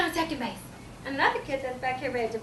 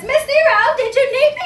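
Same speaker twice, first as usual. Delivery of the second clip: high, loud inquiry; visiting administrator; 11 semitones higher, 14 dB louder than usual. Acted. Administrator appears at the classroom door, high loud query. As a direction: call from the doorway as the administrator. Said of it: "the administrator appears in the door".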